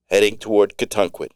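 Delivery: ordinary delivery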